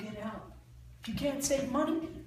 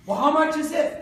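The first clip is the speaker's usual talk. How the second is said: higher, louder eager customer voice; customer asking price; 6 semitones higher, 7 dB louder than usual. Acted. A buyer asking the salesman the price, eager and raised. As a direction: play the buyer asking how much.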